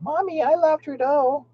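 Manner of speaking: mocking a brainwashed child; mock little kid. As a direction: parrot a small child gushing to mom. Imitating a child won over.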